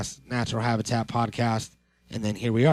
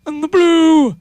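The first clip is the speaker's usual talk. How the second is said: put-on high, loud indignant old man; offended old geezer yelling; 10 semitones higher, 6 dB louder than usual. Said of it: "as old men".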